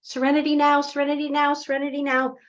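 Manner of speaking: high, rushed anxious chanting; panicked mantra. Acted. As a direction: repeat it urgently, acting out mounting panic. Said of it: "the more panic they start to feel".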